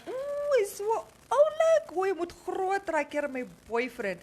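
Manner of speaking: high-pitched mock cooing over a child; gushing older woman. Mother mimicking older women fussing over her son, mock gushing. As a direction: imitate a gushing admirer, high then dropping. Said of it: "clearly older woman would be like".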